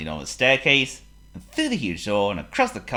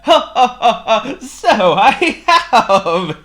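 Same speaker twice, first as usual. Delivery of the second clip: high, loud giggle into drawn-out words; hammed-up laughing voice; giggling king; 14 dB louder, slower than usual. A narrator's reading. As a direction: laugh with newfound delight. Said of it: "he giggled".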